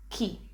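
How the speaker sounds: key as isolated "kee"; Italian sound demo. Shown as a demonstration. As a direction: say key as the Italian syllable chi, hard k. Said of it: "Pronunciation is".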